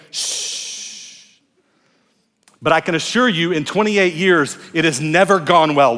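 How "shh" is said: soft whispered shh; gentle loving shush. Retold or imitated. Husband gently shushing his wife, whispered. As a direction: hush her gently and lovingly. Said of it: "gently and lovingly say".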